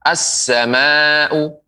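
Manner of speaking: "As-sama'u" very slowly with held s; slow model of "As-sama'u" with tasydid on s; doubled sin. Shown as a demonstration. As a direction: stretch "As-sama'u", long second a, no l. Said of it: "Sehingga sin bertasydid".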